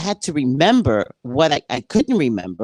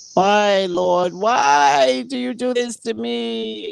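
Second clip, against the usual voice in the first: high, slow, drawn-out wail at the heavens; a higher slow mock lament; whiny lament to God; 8 semitones higher, slower than usual. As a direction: moan up to the Lord like it's unfair. Complaining to God about job.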